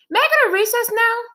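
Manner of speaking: put-on high, loud whiny kid voice; a boy begging to be let out; child Joe. Boy begs his teacher for recess, high and whiny.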